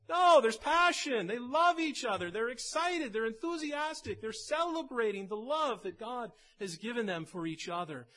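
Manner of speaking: loud, high, excited sermon burst; fervent preaching. Acted. Pastor stirring the church about joyful love, loud preaching. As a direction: preach it with fire to the congregation.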